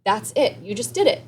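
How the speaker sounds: delighted coach saying you nailed it; excited praise. Retold or imitated. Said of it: "and I was like".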